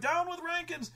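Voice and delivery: very high mock protest yell; rioters' cry. Rioters against the authorities, high protest cry. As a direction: imitate a crowd's slogan, squeaked up. Rioting crowd chants in protest.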